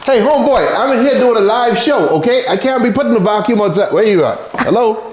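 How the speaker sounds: high-pitched, miffed complaint; indignant calling out. Real, not acted. Host calls to homeboy during live show, high indignant complaint.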